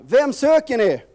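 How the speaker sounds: loud, high challenge to the crowd; calling out. Acted. Jesus calls out loudly to the arresting crowd. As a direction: call it out across the torches. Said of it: "Jesus ropar".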